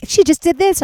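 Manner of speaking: high, loud proud bragging; bragging momager. Retold or imitated. Momager friends bragging about her, high and proud. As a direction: show her off like a proud manager.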